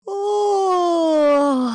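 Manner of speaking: long, high, loud yawning "ohh"; big yawn. A narrator's reading. Green monster drifting off to sleep, big yawn. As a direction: yawn hugely, sliding gradually down. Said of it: "打了一个大大的哈欠".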